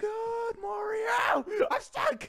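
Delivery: high-pitched panicked cry for help; squealing in gamer panic. Real, not acted. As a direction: yelp it as if trapped and chased. Stuck and chased in game.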